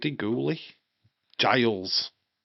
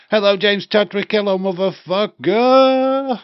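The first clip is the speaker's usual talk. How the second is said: loud playful greeting; 7 semitones higher, 10 dB louder than usual. Real, not acted. Greeting a viewer by name on stream.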